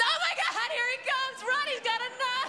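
very high, loud panicked shriek; hammed-up panic at sudden danger; mock terrified scream. Tied-up girlfriend warning the delivery driver about her boyfriend, mock terror.

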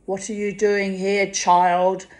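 put-on a gruff Scottish scold at a child; mock Scottish old lady, sharp; Scottish brogue. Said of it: "In her very strong Scottish brogue".

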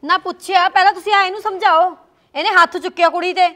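indignant complaint. Acted. Mother protests to grandfather.

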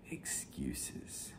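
whispered word. Real, not acted. Preacher quoting the verse on excuses, whispered.

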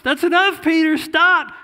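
loud shout telling Peter to stop; stern shout. Acted. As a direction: cut him off with a loud command. Voice stopping Peter mid-rebuke.

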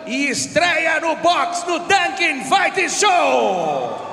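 booming fight introduction, drawn out; ring announcer. Acted.

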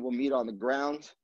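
normal talking voice

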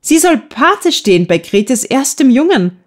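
high, eager delivery; excited announcement. A narrator's reading. Gertrud tells Betty about the christening, high and excited. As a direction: blurt out the happy news. Girls bursting with joyful news.

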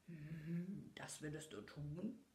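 low, soft beast growl. A narrator's reading. The Beast questions Belle, in a low growl. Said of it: "knurrte das Biest".

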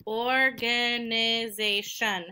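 "Organization" slowly, in five even beats; syllable by syllable. Shown as a demonstration. Teacher repeats the long word, "Organization" slowly split up. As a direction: stretch "Organization" into or, ga, ni, zay, shun. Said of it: "That also has five syllables".

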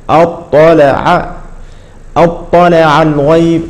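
أطلع الغيب slow, the ط held back; slow correct reading. Shown as a demonstration. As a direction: pronounce أطلع without echo. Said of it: "هكذا ننطقها". (هكذا ننطقها ببطء)

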